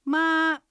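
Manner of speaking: high, flat, sustained "まあ"; first tone. Shown as a demonstration. Teacher demonstrates first tone, high and level. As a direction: hold "まあ" high without any rise or fall. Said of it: "高く音を伸ばします".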